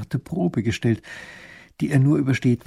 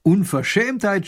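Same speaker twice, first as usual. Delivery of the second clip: scolding; 7 semitones higher, 5 dB louder than usual. Acted. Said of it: "schimpft Raffin".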